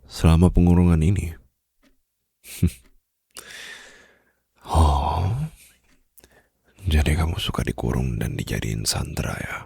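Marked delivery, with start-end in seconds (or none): 4.6-9.6 teasing whisper. Acted. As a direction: whisper it, amused and knowing. (bisikan mengejek manja)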